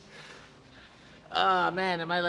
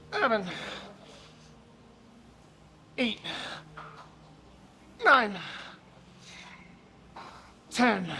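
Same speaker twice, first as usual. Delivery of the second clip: counting reps through effort, higher; straining count; 3 semitones higher, 4 dB quieter, slower than usual. Real, not acted. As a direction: grind out each rep count.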